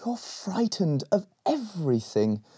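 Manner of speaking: sighing. Acted. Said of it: "sighed Rowan".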